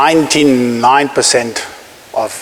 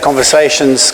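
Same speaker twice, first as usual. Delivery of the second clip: ordinary talk, nothing marked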